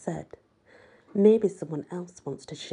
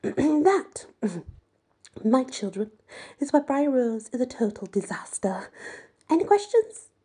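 a lightly voiced snooty headmistress; pitched up a touch, lofty and condescending; haughty teacher voice; 6 semitones higher than usual. A narrator's reading. Narrator voicing Madame lecturing the group, lofty.

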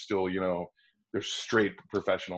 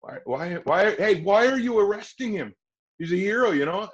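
high pleading outcry, much louder; earnest impassioned audition read; serious intense read; 11 semitones higher, 6 dB louder than usual. Shown as a demonstration. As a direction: shout the plea as if it really matters. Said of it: "I played it more serious".

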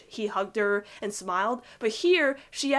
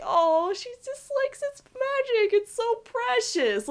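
much higher, cooing over how cute they are; gushing fan squeal; 13 semitones higher, slower than usual. Retold or imitated. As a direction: coo like a swooning shipper. Fan cooing over Ronnie Anne.

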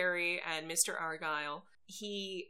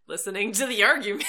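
loud, fast comeback, half laughing; imitating an exasperated answer, quick and loud; mock indignant retort; 3 semitones higher, 8 dB louder, faster than usual. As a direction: fire back an amused, raised-voice retort. Voicing a retort from the show.

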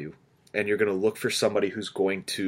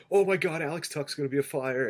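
excited; 7 semitones higher than usual. Retold or imitated. Retelling excited reaction to fake trade.

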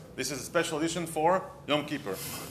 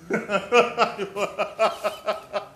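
hearty laugh; 6 semitones higher, 5 dB louder than usual. Real, not acted. Laughs at own joke.

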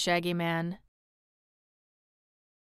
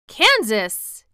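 louder, mildly surprised cry; surprised exclamation; 3 semitones higher, 8 dB louder than usual. A narrator's reading. Fox King hears mention of Kansas, mildly surprised. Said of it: "he exclaimed, surprised".

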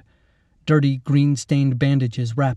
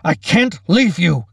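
slow, loud, raised protest; shouted refusal; 6 semitones higher, 6 dB louder than usual. A narrator's reading. Captain refusing to abandon Lacey, desperate shout. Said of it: "Captain Monroe shouted".

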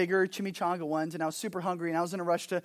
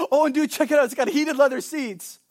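bro showing off car features, upbeat and louder; excited friend; 6 semitones higher, 5 dB louder than usual. Retold or imitated. Friend bragging about his new car, excited. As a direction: hype up the heated seats like a proud friend.

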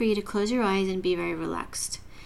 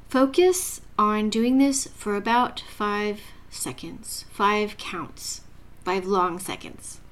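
guided meditation; 3 dB quieter than usual. Acted. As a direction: ease the listener into calm breathing.

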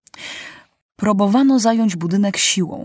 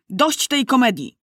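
sharp raised cry, pitched higher; young king shouting; 6 semitones higher than usual. Acted. Young king loses patience with the holdout, shouting. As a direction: snap it out with royal impatience. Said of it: "krzyknął młody król".

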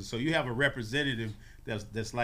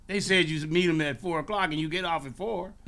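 higher-pitched accusation; accusing handler; 6 semitones higher, 4 dB louder than usual. Retold or imitated. Handler confronting the bird, higher accusing voice. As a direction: lay out the charge, pitched up.

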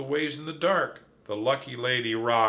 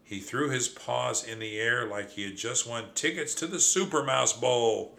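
ordinary delivery, nothing marked

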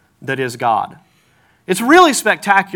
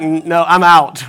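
backing off; 4 dB louder, much slower than usual. Retold or imitated. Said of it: "so I'm like".

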